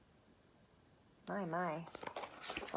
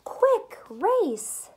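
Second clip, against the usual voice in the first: high then loud, punchy lines; an animated read-aloud cry; excited reading; 6 semitones higher, 13 dB louder, much slower than usual. Acted. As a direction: race through it with a burst of excitement. Reading lively rhyme.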